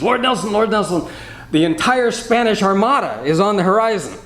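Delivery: high, urgent cry to the admiral; a breathless sailor bursting in with news; urgent calling out. Retold or imitated.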